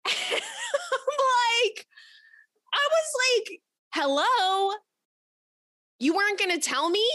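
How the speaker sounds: high, laughing incredulous call-out; mock-outraged shriek at the date; incredulous disbelief. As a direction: squeal at him in disbelief. Retelling shock at exposed breast.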